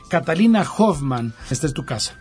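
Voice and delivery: usual speaking voice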